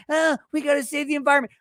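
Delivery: fast, whiny mock quote; mocking environmentalist. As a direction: sneer as you rattle it off. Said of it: "simps sitting there saying".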